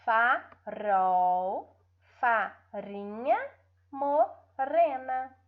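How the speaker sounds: "Farol, farinha, morena" slowly, with trilled R; tongue-tip trill in "Farol, farinha, morena"; weak R. Shown as a demonstration. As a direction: split each word of "Farol, farinha, morena" before a trembling R. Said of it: "vamos ler os fracos".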